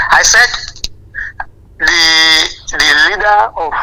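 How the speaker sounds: loud and pushed over the line. Real, not acted. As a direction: call it out clearly into the phone. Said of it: "Yes, speak louder".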